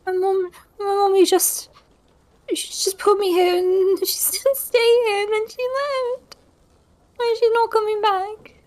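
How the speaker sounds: put-on high, small child's voice near a whisper; child pretending to be abandoned, pitched high; helpless child. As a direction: play a scared lost little kid. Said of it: "Look all helpless".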